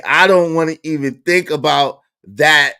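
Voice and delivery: usual speaking voice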